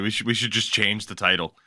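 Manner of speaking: ordinary talk, nothing marked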